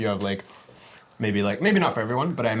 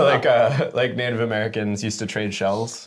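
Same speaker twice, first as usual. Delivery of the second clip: usual speaking voice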